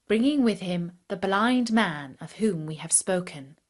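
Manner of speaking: ordinary delivery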